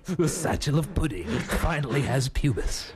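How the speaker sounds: mock-ominous trailer announcer; movie guy voice. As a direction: deliver it as a film trailer tagline. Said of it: "do my movie guy voice on the radio".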